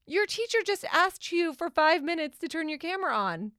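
high-pitched, exasperated mom telling off; retold scolding. Mom retelling how she told daughter off over class camera, exasperated. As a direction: re-enact an incredulous parental reprimand.

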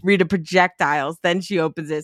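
ordinary talk, nothing marked

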